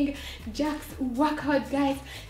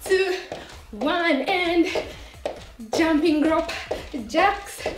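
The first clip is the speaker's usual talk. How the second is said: workout coach; 3 semitones higher than usual. Acted. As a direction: pump up the class like a coach. Energetic workout cues.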